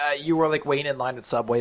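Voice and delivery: ordinary talk, nothing marked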